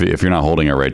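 ordinary talk, nothing marked

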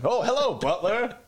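greeting the butler. Pretend. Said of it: "your butler would have let me in".

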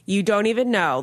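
normal talking voice

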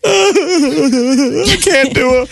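high, breaking into helpless laughter; words squeezed out through real laughter; laughing hard. Real, not acted. Cohost cracks up mid-sentence while joking about crying, laughing hard. Cracking up during cry talk.

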